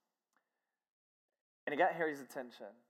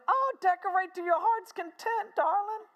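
high, loud mock-female voice; Harry's wife, gushing; 5 dB louder than usual. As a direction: indulge him as the delighted wife. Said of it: "And Harry's wife said".

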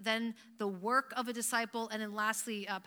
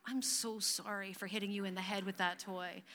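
softer, lower sugary apology; mock contrite child; 3 semitones lower, 7 dB quieter than usual. Child apologizing for hitting brother with toy, mock sincere and soft. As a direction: play an impossibly sincere little kid. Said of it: "Do we expect them to go".

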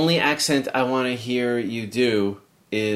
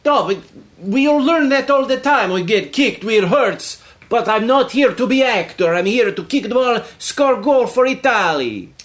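put-on higher-pitched, protesting Italian accent; Italian soccer player; 8 semitones higher, 4 dB louder than usual. Italian player objecting to acting lessons, protesting. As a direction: protest as an indignant Italian footballer.

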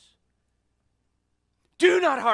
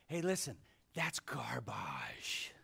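whisper; 10 semitones lower, 13 dB quieter, slower than usual. Real, not acted. Dismisses dream boards in aside.

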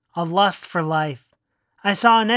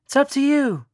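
lightly voiced mother, higher; a hint of a gentle mom, pitched up; mother's line, lightly higher; mom's voice; 5 semitones higher than usual. A narrator's reading.

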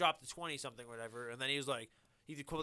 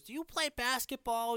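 put-on old woman voice; 10 semitones higher, 4 dB louder, slower than usual. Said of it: "some old woman comes and goes up and he goes".

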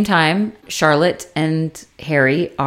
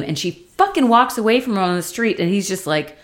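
normal talking voice

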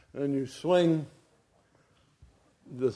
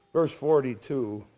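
normal talking voice

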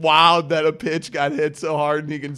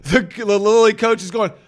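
ordinary talk, nothing marked

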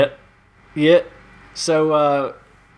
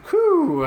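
high breathless whew after laughing; 10 semitones higher than usual. Real, not acted. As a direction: puff out a winded whew. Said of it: "I need to catch my breath".

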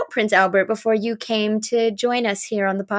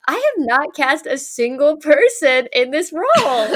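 laughing mock panic; 6 semitones higher, 4 dB louder than usual.

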